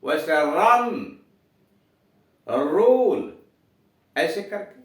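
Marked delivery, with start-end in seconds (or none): 0.5-1.2 रन with a trilled r; rolled English r in run. Shown as a demonstration. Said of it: "जैसे धर्म". 2.5-3.3 रूल with a rolled r; trilled r in rule. Shown as a demonstration. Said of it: "जैसे धर्म".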